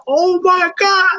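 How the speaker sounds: high slow stunned gasp; shocked. Retold or imitated. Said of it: "I was like".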